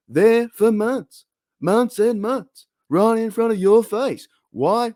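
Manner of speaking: incredulous stand-up rhythm, stretching the key words; Jerry Seinfeld. Acted. Host asking angry fans why, as an exasperated comedian. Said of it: "trying to do my Jerry Seinfeld impression".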